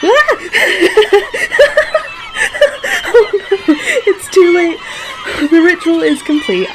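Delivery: high, loud squeals through laughter; laughing panic. Real, not acted. Laughing to her partner as seagulls swarm, high and loud. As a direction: panic and laugh at once, mock doom.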